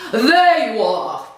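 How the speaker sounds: loud, slow, booming greeting. Acted. Old lady rescuing her from a rude man, booming. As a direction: boom it across the park. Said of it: "she boomed".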